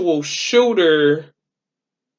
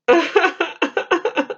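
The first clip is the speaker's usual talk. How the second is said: giddy laugh; 10 semitones higher than usual. Real, not acted. Laughs at first donation.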